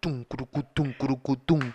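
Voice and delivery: mouth-made drum beat, soft; beatboxed reggaeton beat. Retold or imitated. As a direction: tum crucu like a dembow rhythm. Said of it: "quería imitarlo".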